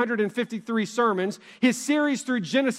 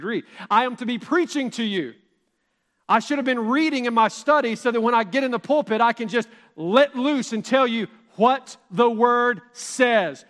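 ordinary delivery

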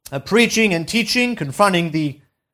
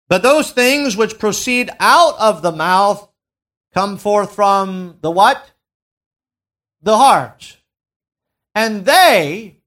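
preached scripture reading; 2 semitones higher, 4 dB louder than usual. Acted. Proclaiming scripture from pulpit.

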